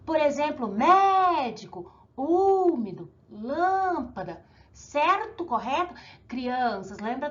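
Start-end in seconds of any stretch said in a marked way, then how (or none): 0.7-1.9 médico with MÉ drawn out loud; slow "médico", holding the MÉ long and strong; stressed antepenultimate syllable. Shown as a demonstration. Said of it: "antepenúltima sílaba é". 2.2-3.1 úmido with Ú long and loud; stressed antepenultimate syllable. Shown as a demonstration. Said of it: "antepenúltima sílaba é". 3.3-4.5 lâmpada slowed on a long LÂM; stressed antepenultimate syllable. Shown as a demonstration. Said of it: "antepenúltima sílaba é".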